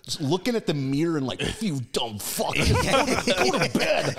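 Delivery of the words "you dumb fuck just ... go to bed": higher-pitched mock scolding, half laughing; hammed-up parental telling-off; angry parent. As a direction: play an exasperated parent ordering someone to bed. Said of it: "I'm like an angry parent".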